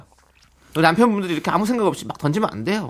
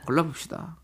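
'걸러봅시다' repeated much softer; quiet echo; 10 dB quieter than usual. Shown as a demonstration. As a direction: echo it softly to yourself. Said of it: "한번". ('걸러봅시다' 조용히 되뇌듯)

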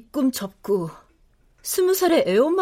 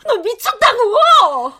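much higher, loud tearful yelling; furious shrieking; 11 semitones higher, 5 dB louder than usual. Acted. Mother screams at her daughter over the pregnancy, furious and tearful.

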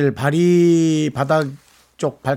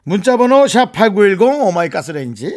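bright, punchy promo read; announcer read; 6 semitones higher, 5 dB louder than usual. Acted. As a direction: rattle it off like a radio ad. Announcer read of text info.

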